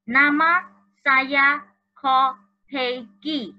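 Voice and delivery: slow, deliberate Malay dictation; slow clear reading. Shown as a demonstration. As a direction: spell it out slowly for young learners. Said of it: "第二题是".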